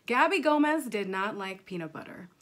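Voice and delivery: ordinary delivery, nothing marked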